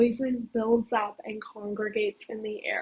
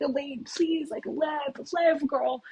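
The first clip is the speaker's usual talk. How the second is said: higher, pleading in mock panic; hysterical pleading; 5 semitones higher, slower than usual. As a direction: beg her to live, half-panicked. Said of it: "like, we're hysterical".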